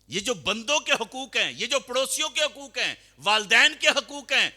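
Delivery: high, loud chanted list of rights; preaching at full force. Acted. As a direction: ring out each right to the congregation. Preacher lists rights loudly.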